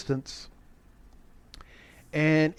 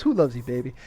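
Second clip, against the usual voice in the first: lightly voiced cool tough guy, pitched down; Kojak; 6 semitones lower, faster than usual. Reviewer quoting Kojak greeting a guy, low and offhand. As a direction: drop low for a casual catchphrase. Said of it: "looks at the guy, goes".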